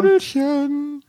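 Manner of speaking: high, slow ghostly wail. Retold or imitated. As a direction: drag out the pet name eerily. Echoing the ghost's call.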